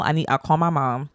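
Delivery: ordinary talk, nothing marked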